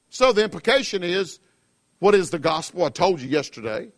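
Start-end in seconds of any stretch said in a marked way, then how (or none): none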